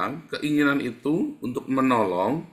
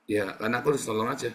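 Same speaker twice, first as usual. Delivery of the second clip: quick, brushed-off mumbling answers; defensive excuse; faster than usual. Acted. A helper voiced by the preacher, hurrying through excuses. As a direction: rattle off the excuse fast.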